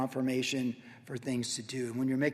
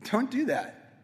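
"Don't do that" higher and fuller; higher, stronger "Don't do that"; firm warning; 5 semitones higher, slower than usual. Shown as a demonstration. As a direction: warn with "Don't do that" raised and firm. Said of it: "it's not like that".

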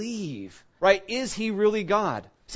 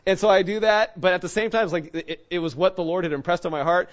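ordinary talk

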